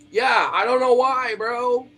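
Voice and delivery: loud, high exclamation; high-pitched, baffled yell; exasperated outburst. Real, not acted. Exasperated outburst about the cat.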